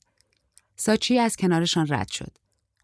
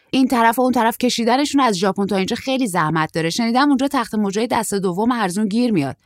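put-on slightly raised voice of a chatty young man; higher, louder character voice, understated; lightly voiced young surfer; 5 semitones higher, 5 dB louder than usual. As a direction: lightly play a traveller explaining his plan. Tall surfer explains.

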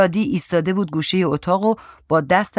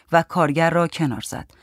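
ordinary delivery

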